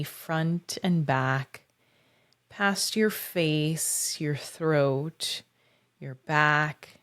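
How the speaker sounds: unhurried visualization with long pauses; measured meditation voice, lingering on key words; guided meditation. Acted. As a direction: guide listeners gently inward, phrase by phrase.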